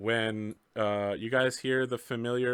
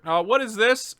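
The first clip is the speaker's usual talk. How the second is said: higher, louder officer calling out; polite but pointed, higher and louder; police officer; 9 semitones higher, 6 dB louder than usual. Acted.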